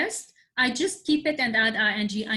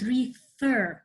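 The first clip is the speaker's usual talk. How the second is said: refer with a drawn-out, stressed FER; stress on second syllable. Shown as a demonstration. Teacher asking what pupils notice, second syllable stressed. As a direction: make FER stand out in refer. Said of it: "The sound".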